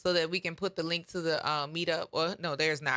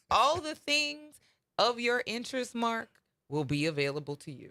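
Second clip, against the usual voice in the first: slow, every word spelled out; over enunciating; 4 semitones higher than usual. Shown as a demonstration. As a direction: lay each word down slowly and clearly. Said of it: "Let me say this slowly".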